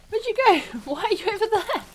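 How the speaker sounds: high-pitched, playful call after a lost companion. Real, not acted. Calling her lost horse in game.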